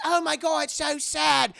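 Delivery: very high loud mock sympathy; high loud hammed-up pity; fake pity. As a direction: overdo the fake sadness. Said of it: "And like".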